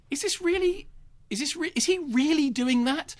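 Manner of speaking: high-pitched incredulous questioning; mock-stunned viewer, stopping and restarting; acted disbelief. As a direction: gape aloud, voice climbing way up.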